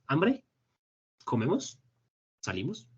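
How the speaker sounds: "Hambre", "Comemos", "Salimos" each lifted into a question; "Hambre", "Comemos", "Salimos" as casual rising questions, paused between; question intonation. Shown as a demonstration. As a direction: make "Hambre", "Comemos", "Salimos" separate one-word questions. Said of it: "solo con la entonación de la pregunta".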